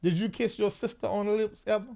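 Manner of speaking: ordinary talk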